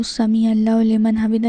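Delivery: quick, run-together recitation; hurried recitation. Shown as a demonstration. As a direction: rattle it off as a mistake to avoid. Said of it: "ایسے نہیں پڑھنا ہے".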